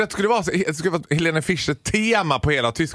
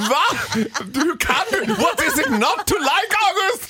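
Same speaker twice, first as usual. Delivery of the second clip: mock indignant disbelief; 13 semitones higher than usual. Scolding the boyfriend August for not liking the concert, mock indignant. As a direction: act shocked he could dislike it. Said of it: "Jag bara".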